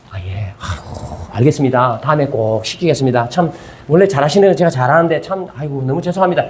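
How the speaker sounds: low, subdued voice with a resigned sigh; sighing, placating apology. Acted. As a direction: apologize meekly, giving in. Acting out placating apology to complainers.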